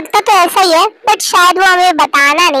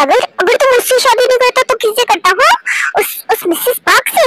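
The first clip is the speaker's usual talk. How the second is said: jealous anger; 6 semitones higher than usual. Acted. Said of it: "इतना गुस्सा क्यों कर रही हो?".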